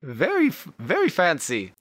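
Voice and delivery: high, bright-voiced lady shopkeeper. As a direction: gush over their outfits in a high lady voice. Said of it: "And the lady says".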